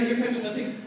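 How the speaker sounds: ordinary talk, nothing marked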